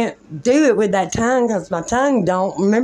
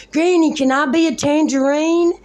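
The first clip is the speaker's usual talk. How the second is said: put-on eager little child; 7 semitones higher than usual. Child self running to granny.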